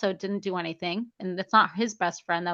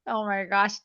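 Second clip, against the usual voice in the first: ordinary talk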